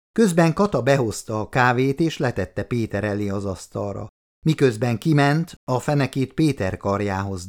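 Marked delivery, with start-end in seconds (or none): none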